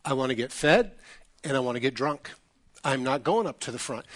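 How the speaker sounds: low, soft, stubborn grumble; retold sulky refusal. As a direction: play a father digging his heels in. Sulking father refuses to move.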